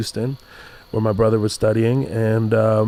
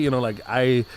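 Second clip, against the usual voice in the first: normal talking voice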